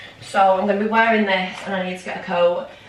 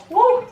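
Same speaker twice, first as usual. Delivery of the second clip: cheer; 5 dB louder than usual. Real, not acted. Checking time, cheering.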